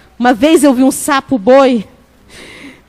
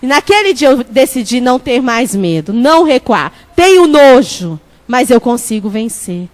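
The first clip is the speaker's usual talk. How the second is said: ordinary talk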